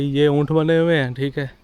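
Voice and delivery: usual speaking voice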